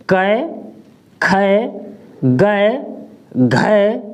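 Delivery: कै खै गै घै each said apart; slow syllable drill of कै खै गै घै; ai matra series. Shown as a demonstration. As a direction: recite the row of ai sounds. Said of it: "इसी तरह से".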